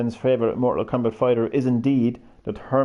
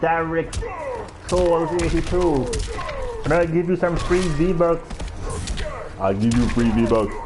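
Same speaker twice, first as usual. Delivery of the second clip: terrible Terminator; 5 semitones higher than usual. Acted. Streamer plugs his channel as the Terminator, terrible Terminator accent. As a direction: do a poor, deliberate Terminator impression. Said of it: "That is my terrible Terminator accent".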